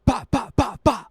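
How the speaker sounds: high, loud mouth-made gunshots; imitated gunfire. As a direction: rattle off the shots like popping guns. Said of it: "and we hear".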